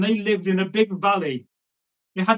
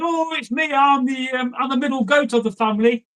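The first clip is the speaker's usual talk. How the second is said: higher-pitched little goat voice; middle goat; 5 semitones higher than usual. Acted. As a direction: answer the troll in a raised, innocent pitch. Middle goat answers the troll.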